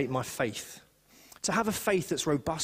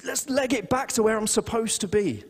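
urgent realization; 8 semitones higher than usual. Retold or imitated.

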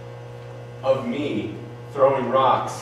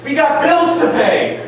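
loud, slow, high yell; angry shout; 11 semitones higher, 6 dB louder, slower than usual. Acted. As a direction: yell it in a panic. Spouse yells about bills.

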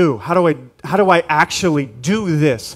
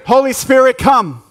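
louder call; 7 semitones higher, 4 dB louder than usual. Shown as a demonstration. Congregational call, repeated.

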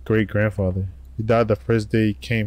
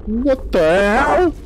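slow, high, loud shriek of shock; drawn-out shocked scream; 14 semitones higher, 5 dB louder, much slower than usual. Real, not acted. Gamer jump-scared, screaming slowly and high. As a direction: stretch it into a startled scream. Sudden scare in horror game.

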